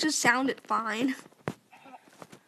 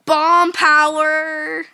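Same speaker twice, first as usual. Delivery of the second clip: slow, loud hype call; hype shout; 3 semitones higher, 12 dB louder, much slower than usual. Acted. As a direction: belt it out like a YouTuber intro. Game intro shout, slow.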